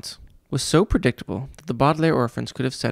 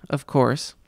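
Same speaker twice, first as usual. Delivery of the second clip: usual speaking voice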